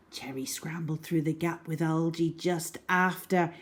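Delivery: plain reading voice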